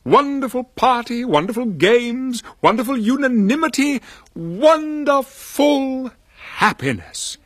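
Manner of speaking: high, swelling wonderful list; rapturous narration. A narrator's reading. Narrator rhapsodizes about the party.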